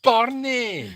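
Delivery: loud, high call to a dog. Real, not acted. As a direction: call the dog sharply by name. Calling to the dog Barney.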